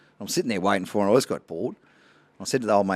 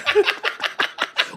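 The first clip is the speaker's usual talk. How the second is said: high airy burst of laughter; cracking up at the punchline. Real, not acted.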